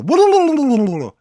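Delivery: loud high wailing howl; imitated scream noise. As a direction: let out a long ghostly wail. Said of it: "like that noise".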